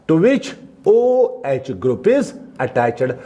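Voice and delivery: the heading read word by word, slowly; slow dictation. Shown as a demonstration. Teacher dictates a heading for notes, slowly. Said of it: "dictation repeated".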